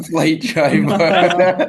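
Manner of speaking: loud, high, slow through real laughter; cracking up. Real, not acted. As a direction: repeat it helplessly laughing. Cracking up at friend's wrong word.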